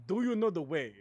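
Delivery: mild mock African meme accent; Ugandan Knuckles impression. Streamer quoting a meme character, mock African accent. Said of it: "Ugandan Knuckles".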